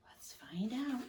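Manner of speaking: soft secretive whisper. Real, not acted. Reader whispering to the child, building suspense.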